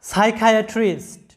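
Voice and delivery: সাইকিয়াট্রিস্ট said slowly, syllable by syllable, with a hard k for ch; slow, clear demo of সাইকিয়াট্রিস্ট; model pronunciation. Shown as a demonstration. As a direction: spell out সাইকিয়াট্রিস্ট slowly for learners. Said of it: "লক্ষ্য করো যে".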